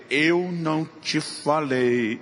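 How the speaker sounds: very slow, pointed scolding; father scolding his crying son, slow and pointed. Retold or imitated. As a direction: drag out Eu não te falei as a pointed rebuke. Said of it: "eu aponto o dedo pra ele e falei".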